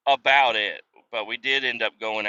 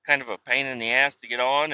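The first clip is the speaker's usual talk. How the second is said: ordinary delivery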